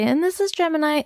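high-pitched imitation of wife's intro; wife's intro line. Standing in for absent wife on podcast intro, high-pitched imitation. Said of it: "the part where my wife would say".

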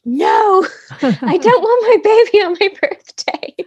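high, loud mock wail of protest; dismayed wail. Mother-to-be protesting labour on her birthday, high dismayed wail. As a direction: wail it in comic dismay, laughing.

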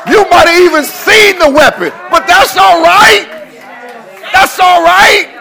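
loud, high shouted preaching; full-force preaching. Real, not acted. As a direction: whoop it up over the weapon. Preaching climax.

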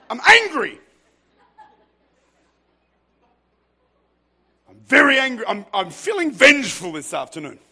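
high, loud, hammed-up grouch; mock-angry God, played for laughs; grumpy old dude. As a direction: grumble loudly like a cranky old man. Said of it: "some grumpy old dude in the sky".

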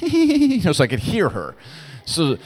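bursting into a high laugh. Real, not acted. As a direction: laugh it out loud mid-sentence. Laughing recalling daughter snickering.

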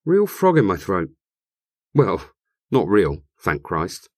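usual speaking voice

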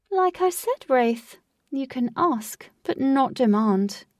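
lightly voiced theatrical host; proud showman tour guide; grand host voice. Lutz calm superior rebuke.

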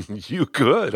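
amused, say it through a small laugh; chuckling agreement. A narrator's reading. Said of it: "I laughed".

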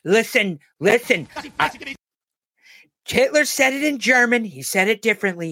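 mock Trump bragging about his speeches; Trump impression. Host mocking Trump's rally speech in a high Trump voice. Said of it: "Trump impression after "he's".

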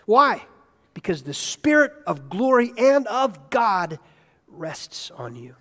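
usual speaking voice

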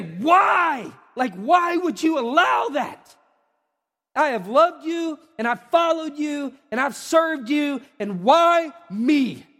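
loud, high, slow shouted why; angry cry to God. Acted. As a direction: pour out anger at God. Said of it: "you really would just like to say".

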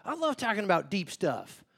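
high, bubbly mock enthusiasm; eager oversharer. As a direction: play the keen one who loves to talk about themselves. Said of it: "some of you are like".

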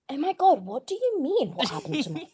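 high, mock-flustered squeak, breaking into laughter; changed voice. Dominatrix caught out by her client, in a high, flustered voice. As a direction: go high and feign innocence, laughing at the end. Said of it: "my voice had changed".

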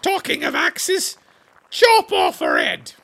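shouted order. Acted. Duchess orders beheading.

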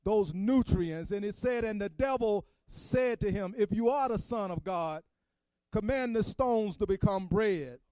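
Good Friday preacher pushing toward the climax; preaching. Acted. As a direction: preach it to the congregation, gathering steam.

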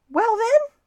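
high, loud, cheery opener; cheerful. A narrator's reading. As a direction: burst out brightly with a new idea. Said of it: "said Stewart cheerfully".

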